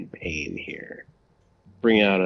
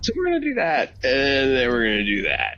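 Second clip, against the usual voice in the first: excited; 7 semitones higher than usual. Real, not acted. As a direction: build it up playfully. Building playful plays.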